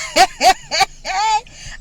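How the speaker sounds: a high, loud burst of laughter. Real, not acted. Mother laughing while telling her daughter's story, loud laugh.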